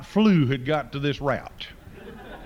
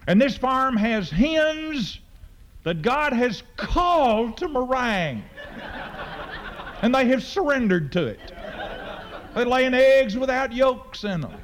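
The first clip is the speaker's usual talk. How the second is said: mock-reverent build; 7 semitones higher than usual. Preacher telling the crowd about meringue-called hens, mock-solemn and high.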